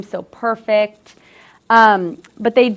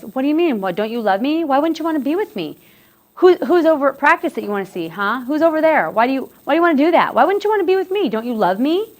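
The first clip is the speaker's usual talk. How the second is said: pleading, accusing voice, much higher; whiny guilt-trip, pitched up; imitating a clingy partner; 6 semitones higher than usual. Presenter acts out a controlling partner, pleading and accusing. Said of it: "and the partner says".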